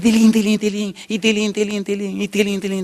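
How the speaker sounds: high, rapid singsong "tilín" jingle; imitated bell ringing. Storyteller mimics the bell ringing inside, high jingle.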